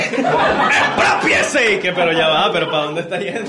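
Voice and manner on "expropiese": loud drawn-out mock decree; Chávez shout. Friend joins in doing Chávez, loud and slow. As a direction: bellow it slowly like Chávez.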